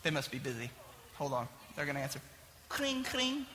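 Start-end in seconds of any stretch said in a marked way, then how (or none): 2.7-3.5 soft "cring, cring" phone ring; imitated phone ring. Caller waiting on the line, mimicking the phone ringing softly. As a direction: hum the ringtone quietly, like a phone line.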